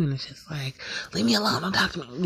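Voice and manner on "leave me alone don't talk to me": irritated brush-off at her man; irritated snap. Pretend. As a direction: push him away, irritated. She snaps at her man.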